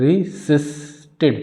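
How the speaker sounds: "resisted" slowly, syllable by syllable; "resisted" split up with small gaps for learners; broken into syllables. Shown as a demonstration. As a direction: sound out "resisted" in separate pieces, re-sis-ted. Sounding out an English word for learners.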